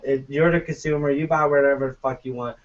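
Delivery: normal talking voice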